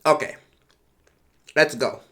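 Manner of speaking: ordinary delivery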